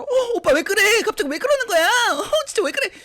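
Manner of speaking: high, loud alarmed questions; startled loud protest. Acted. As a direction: cry out startled at brother. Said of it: "놀래서 왜 그러냐면서 크게 말하니까".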